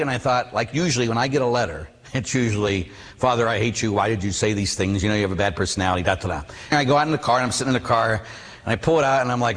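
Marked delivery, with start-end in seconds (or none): 3.2-6.4 low, offhand recital of complaints; mock hate mail, tossed away; dismissive rattling-off. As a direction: reel it off like a letter heard many times. Rattles off typical hate letters.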